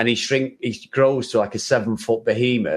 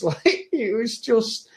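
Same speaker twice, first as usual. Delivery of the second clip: normal talking voice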